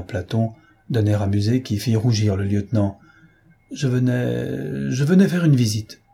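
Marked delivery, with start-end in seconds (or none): 3.7-4.9 hesitant. Acted. Embarrassed stammer, repeats words. 4.9-6.0 repeated "venais" pushed out higher and louder; blurted out. Acted. Embarrassed stammer, repeats words.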